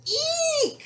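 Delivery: very high, loud squeal; cows' shriek. A narrator's reading. Cows hear the wolf growl, high frightened shriek. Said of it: "said the cows".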